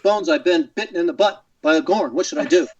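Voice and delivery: dramatic captain in choppy phrases; Captain Kirk. Shown as a demonstration. Guest correcting his old voicemail line, mock-serious captain. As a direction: deliver the silly injury with grave, halting urgency. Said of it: "and then Kirk would say".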